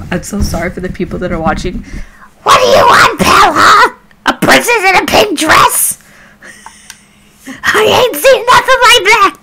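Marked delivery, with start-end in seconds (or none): 2.4-9.4 Toad. Acted. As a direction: squawk it like a cheeky little mushroom man. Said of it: "time to do Toad's voice".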